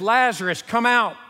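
called out loud toward the tomb; commanding call into an open grave; shout. Retold or imitated. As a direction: call the dead man out loudly. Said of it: "and he shouts in the direction".

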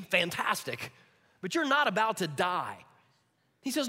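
normal talking voice

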